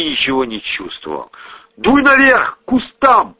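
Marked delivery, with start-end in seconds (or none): 1.8-3.4 high loud barked order; commanding shout. A narrator's reading. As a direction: order him off the beach. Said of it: "приказал брат".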